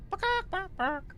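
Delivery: high, soft clucking imitation; chicken clucking.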